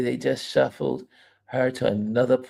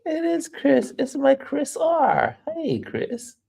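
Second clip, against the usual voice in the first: high, slow happy realization; delighted recognition. Real, not acted. Streamer realizing the viewer is her friend, delighted.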